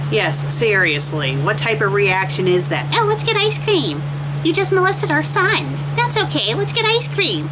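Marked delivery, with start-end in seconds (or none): 2.9-7.5 high, silly mocking parent. Reviewer mocking the story's parent, high and silly. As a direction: ham up the clueless parent. Mock character dialogue.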